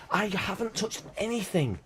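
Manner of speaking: high, put-on denial in his wife's voice; mock innocent wife. Wife caught unplugging the Wi-Fi, in a high mock-innocent voice. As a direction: play the wife protesting she touched nothing. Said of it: "She's like".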